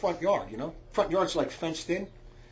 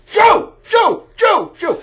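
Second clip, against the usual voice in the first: loud shout; 16 dB louder, much slower than usual. Shown as a demonstration. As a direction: yell it out high and hard. Better, better.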